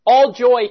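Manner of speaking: "all joy" said high and strong against the natural reaction; emphatic all joy. Shown as a demonstration. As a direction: hit "all joy" hard as a rebuttal. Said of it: "this contradiction here saying that no".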